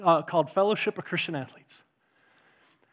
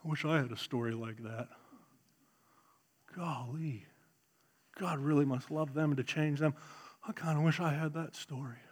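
low, very soft wistful murmur; musing to himself; 5 semitones lower, 14 dB quieter than usual. Retold or imitated. Preacher voicing his freshman self's private wish, hushed wistful musing. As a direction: think aloud in a hushed, longing voice. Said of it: "sitting over here in my own little world thinking".

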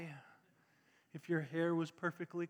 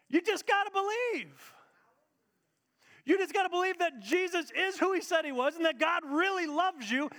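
much higher, louder preaching; fervent preaching; 9 semitones higher, 3 dB louder than usual. Real, not acted. Preacher urging the congregation, high and loud. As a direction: preach it with full force.

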